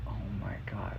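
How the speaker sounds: a stunned, emotional hush; choked up. Retold or imitated. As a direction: let it catch in your throat. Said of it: "Like, I'm getting emotional".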